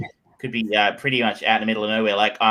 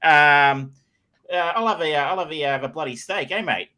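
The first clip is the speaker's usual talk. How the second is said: broad Aussie, higher and louder; hammed-up ocker accent; 7 semitones higher, 5 dB louder than usual. Australian in the States playing up his ocker accent, hammed up and loud. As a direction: lay the outback Aussie on thick. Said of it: "turn that ocker up to eleven".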